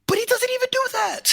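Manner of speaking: very high-pitched exasperated complaint; exasperated squeal. Real, not acted. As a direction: let exasperation send the voice way up. Exasperated complaint about the botched reveal.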